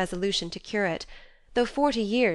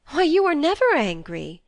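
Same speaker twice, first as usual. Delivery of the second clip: higher, louder disbelieving exclamation; a daughter astonished at her mother; surprised; 9 semitones higher, 7 dB louder than usual. A narrator's reading. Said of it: "Joe forgot remorse in surprise".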